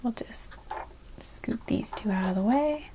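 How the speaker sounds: soft unvoiced whisper. Real, not acted. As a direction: whisper it under your breath. Quietly moving cards aside.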